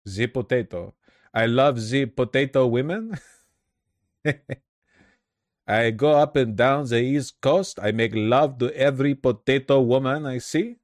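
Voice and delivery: exaggerated foreign-accented impression; boastful lover in a mock foreign accent; J F Gariepi. Impersonates Gariepi, then "what a guy.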